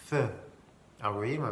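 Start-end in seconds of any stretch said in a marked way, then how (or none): 0.1-0.2 "th" with tongue between the teeth. Shown as a demonstration. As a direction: let air hiss past the tongue on "th". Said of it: "ma langue, elle sort là". (« th », la langue entre les dents)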